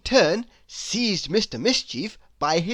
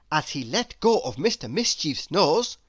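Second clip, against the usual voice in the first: usual speaking voice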